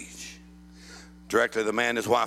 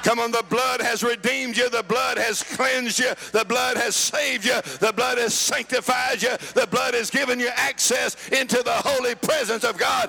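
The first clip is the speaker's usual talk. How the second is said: rhythmic, building revival sermon; driving preaching; 12 semitones higher than usual. Real, not acted. Preacher tells congregation what the blood has done, driving rhythmic preaching. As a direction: build each declaration harder than the last.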